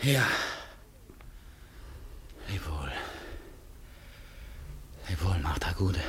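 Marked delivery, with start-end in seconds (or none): 2.5-6.0 soft whispered goodbye; whispered farewell. Acted. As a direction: breathe the farewell almost silently. Quiet farewell. (geflüstertes Lebewohl)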